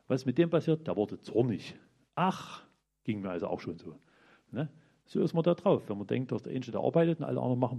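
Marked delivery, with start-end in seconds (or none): none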